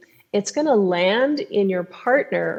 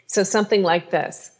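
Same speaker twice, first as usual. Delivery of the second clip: ordinary delivery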